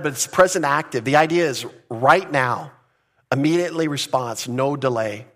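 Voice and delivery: ordinary talk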